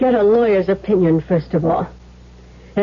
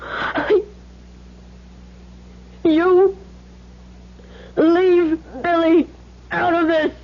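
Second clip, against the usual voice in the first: frightened plea; 7 semitones higher, much slower than usual. Acted. Mother defends son from blackmailer.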